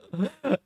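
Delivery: short amused chuckle; quick real chuckle; laugh. Real, not acted. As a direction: laugh at the mess on screen. Watching match, laughs aloud.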